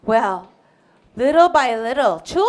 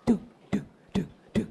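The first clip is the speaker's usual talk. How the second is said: whispered thudding heartbeat; soft, whispered beats; imitated heartbeat; 5 semitones lower, 10 dB quieter, much faster than usual. A narrator's reading. Storyteller sounds a child's racing heart, hushed. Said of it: "her heart was beating very fast".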